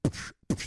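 unvoiced movie punch psh; movie punch sound effect. Shown as a demonstration. Demonstrating how movie punches sound, voiced punch psh. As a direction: mimic a Hollywood punch hit. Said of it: "punching in movies always sounds like this".

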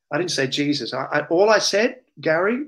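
ordinary delivery

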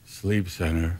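low, soft, deadpan phone answer; curt low receptionist. Retold or imitated. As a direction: answer in a dull low monotone. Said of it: "He went".

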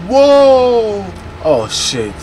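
ordinary talk

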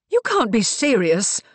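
acted incredulous exclamation; blurted disbelief. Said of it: "Mariana blurted the words out".

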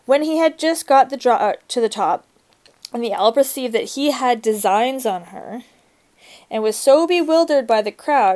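reading a fairy tale aloud to a class; story reading. A narrator's reading. Teacher reads story aloud.

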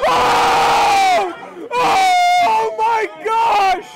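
screaming in disbelief; excited shout. Real, not acted. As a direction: shout it out, voice cracking high. Caster screaming at big play.

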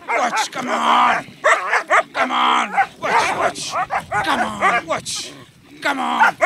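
loud, high excited shouts; firm dog commands. Real, not acted. Agitating dog during bite training.

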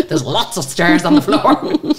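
loud, slow, through real laughter; laughing joke. Real, not acted. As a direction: keep laughing as you explain. Joking about dropped names.

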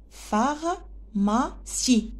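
"Pharmacie" very slowly as phar, ma, cie; three separate beats in "Pharmacie", pausing after each; split into syllables. Shown as a demonstration. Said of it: "On a trois syllabes". (« Pharmacie » découpé très lentement, phar-ma-cie)

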